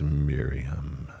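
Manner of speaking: low, hushed name; gentle, intimate recognition; tender calling. Acted. Jesus tenderly names weeping Mary.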